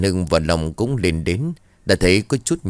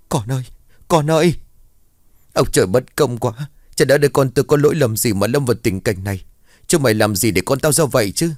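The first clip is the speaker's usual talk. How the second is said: wailing mother; 5 semitones higher than usual. A narrator's reading. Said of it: "bà mếu máo khóc lóc". (người mẹ gào khóc thảm thiết, giọng cao và to)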